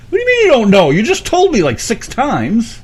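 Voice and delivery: loud, high exasperated outburst. Real, not acted. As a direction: blurt it out in disbelief. Exasperated at forgotten name.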